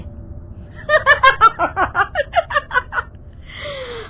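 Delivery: long, high-pitched cackle; villainous laughter, trailing off; evil witch cackle. Acted. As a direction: laugh piercingly as the spell takes. Witch bewitches the two men.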